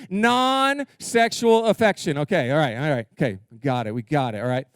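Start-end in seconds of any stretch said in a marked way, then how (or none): 0.1-2.2 non-sexual drawn out slowly; slow emphasis. Shown as a demonstration. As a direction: lead the crowd slowly. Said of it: "say it with me".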